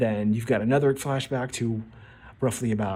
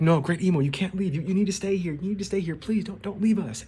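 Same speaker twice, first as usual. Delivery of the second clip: higher, desperate begging elders; pleading Gorosei; 7 semitones higher than usual. Acted. Gorosei plead with Imu not to leave, higher and desperate. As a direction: beg your master not to leave.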